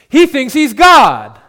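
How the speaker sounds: loud, slow, laughing scorn; mocking jeer. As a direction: sneer it at the crowd. Mocking crowd jeers.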